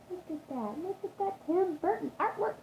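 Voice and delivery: high-pitched little gush; admiring. Real, not acted. Showing viewers the book's artwork, high admiring gush.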